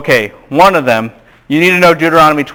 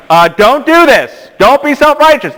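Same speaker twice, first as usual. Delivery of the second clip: mock scolding; 10 semitones higher than usual. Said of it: "Deuteronomy's like".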